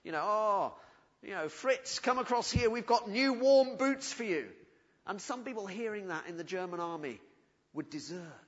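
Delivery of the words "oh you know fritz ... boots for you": louder, higher call across the lines; a soldier calling to the other side's trench. Retold or imitated. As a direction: shout it over to the enemy trenches. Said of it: "They would call across to the front lines".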